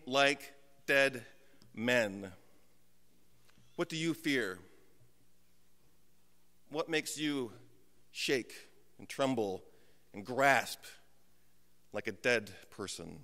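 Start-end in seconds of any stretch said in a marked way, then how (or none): 3.8-13.2 hushed solemn preaching. Acted. Pastor asking the congregation, hushed grave questions. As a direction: draw the listeners in quietly.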